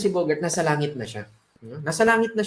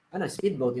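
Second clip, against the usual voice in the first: ordinary talk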